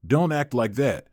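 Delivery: plain reading voice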